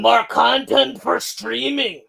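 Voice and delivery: slow, drawn-out greedy boss voice; corporate exec voice. Retold or imitated. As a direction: drag it out like a greedy studio exec. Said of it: "I'm pretending to be Disney".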